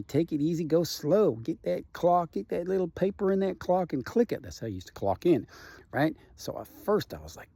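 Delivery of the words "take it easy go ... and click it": Paul telling him to relax. Retold or imitated. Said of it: "just telling me".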